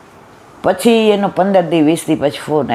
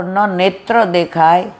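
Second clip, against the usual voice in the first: ordinary talk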